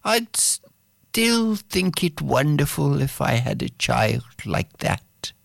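put-on high, sighing wish for a child; longing mother. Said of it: "sighs and says".

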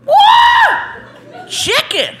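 squealing high with delight; high excited shriek at each find; man opening a gift basket, shrieking with joy; thrilled shriek. Retold or imitated. Man finding basket items.

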